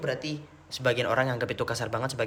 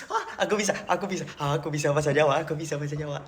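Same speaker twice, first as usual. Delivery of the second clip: gleeful boast; faster than usual. Real, not acted. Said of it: "Yeay, aku iso ngomong bahasa Jawa".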